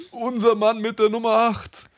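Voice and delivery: echoing stadium announcer; high, slow goal call with echo. Pretend. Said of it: "Stadionansager wär ich auch gern".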